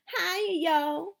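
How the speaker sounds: high, loud mimicking country drawl; mocking imitation. A narrator's reading. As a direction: parrot the new kid to mock him. Kids mocking new boy's greeting.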